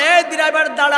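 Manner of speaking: high, loud imitated call to halt. Vehicle owner ordering his driver to stop, calling out. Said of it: "মালিক ডেকে বলে".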